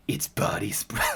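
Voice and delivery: low, hushed stilted line read; soft put-on line, about to crack; mock character voice. Reviewer reading awful comic dialogue, hushed mock-stilted. Reads bad dialogue, breaking up.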